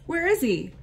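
frightened doe. A narrator's reading.

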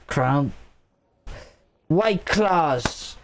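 slurred, rambling tipsy speech. Real, not acted. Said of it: "Your drunk voice is making me wanna laugh".

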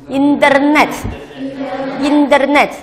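internet twice, very slow, front consonants kept hard. Shown as a demonstration. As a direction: stretch internet out slowly, no softening. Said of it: "但是这个音不能软化".

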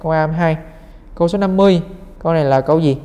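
ordinary talk

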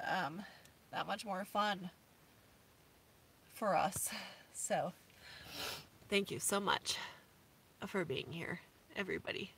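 low, soft, heartfelt thanks; subdued voice, still holding back tears; emotional after crying. Real, not acted. Thanking viewers for making van life fun, softly choked up. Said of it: "crying in front of you guys".

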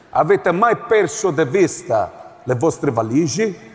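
ordinary talk, nothing marked

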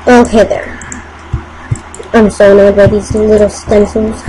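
ordinary delivery

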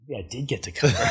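said through a laugh. Retold or imitated. Bragging to the girls in the bar, laughing through it. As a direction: chuckle it out proudly. Laughing while quoting himself.

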